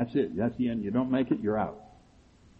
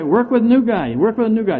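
higher, louder mocking parrot; mocking advice; 9 semitones higher, 8 dB louder than usual.

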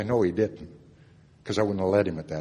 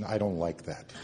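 usual speaking voice

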